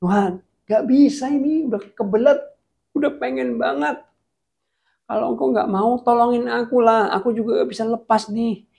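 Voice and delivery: high-pitched, urgent begging; acted whiny desperation; desperate pleading. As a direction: plead like you can't hold it in. Acts out man begging God, desperate.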